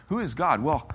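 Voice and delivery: ordinary talk, nothing marked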